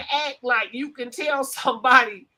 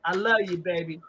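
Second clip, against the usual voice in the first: lower, soft mock pimp crooning "baby"; mock sweet-talk; 9 semitones lower, 5 dB quieter, faster than usual. Host mimics a pimp sweet-talking his woman, low and soft. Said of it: "Pimp school".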